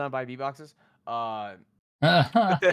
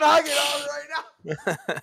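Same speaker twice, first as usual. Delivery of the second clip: mocking whine; 10 semitones higher, 4 dB louder than usual. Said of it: "He said".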